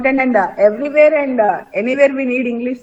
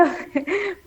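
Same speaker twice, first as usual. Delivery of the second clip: brief embarrassed giggle; a quick shy laugh; short nervous laugh; 6 semitones higher, 10 dB quieter than usual. Real, not acted. Awkward answer to teacher.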